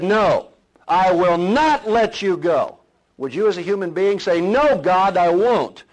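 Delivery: loud, rising preacher's exhortation; preaching at full force. Real, not acted. Preacher rousing his church about not quitting, loud and fervent. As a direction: thunder it to the congregation.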